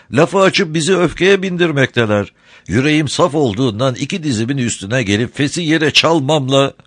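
high, sweet-talking crafty smuggler; sly, sugary old rogue; honeyed voice. A narrator's reading. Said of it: "tatlı konuşuyor".

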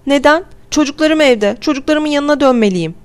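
high-pitched, urgent mother's protest; woman crying out. A narrator's reading. Mother protesting that she must reach her children, crying out. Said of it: "Bir kadın bağırdı".

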